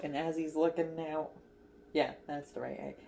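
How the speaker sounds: usual speaking voice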